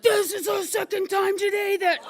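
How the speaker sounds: driver's meltdown. Retold or imitated. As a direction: wail it out as the unhinged driver. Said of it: "this driver's having a complete meltdown".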